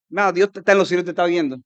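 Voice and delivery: usual speaking voice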